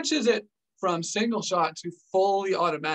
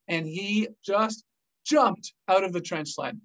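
usual speaking voice

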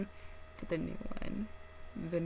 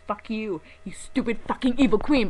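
mock outrage; 4 semitones higher, 6 dB louder than usual. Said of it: "I'm like".

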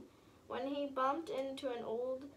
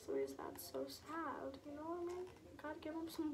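much softer, quick joking remark; soft conspiratorial aside; hushed aside; 14 dB quieter, faster than usual. Real, not acted. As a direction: slip in a quiet joke to the viewers.

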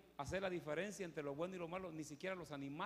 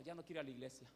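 normal talking voice

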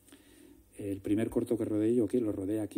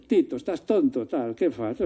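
scoffing sister; 3 semitones higher, 9 dB louder than usual. Retold or imitated.

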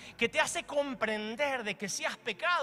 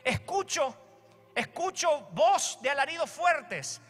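higher and slow, half-shouted; slow, raised proclaiming voice; calling out; 6 semitones higher, 3 dB louder, slower than usual. Acted. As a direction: proclaim it as Moses. Moses hears the shouting.

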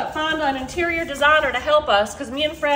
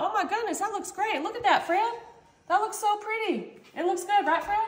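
high, thrilled admiration; delighted; 8 semitones higher than usual. Real, not acted. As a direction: gush over how pretty it looks. Admiring the cake.

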